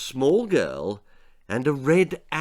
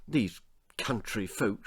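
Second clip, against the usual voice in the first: softer, rueful sigh of a line; sad; 3 semitones lower, 8 dB quieter than usual. Acted. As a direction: say it with quiet dismay. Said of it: "she said sadly".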